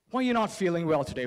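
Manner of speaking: ordinary talk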